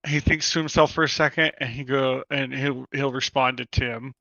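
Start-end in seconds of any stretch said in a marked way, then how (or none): none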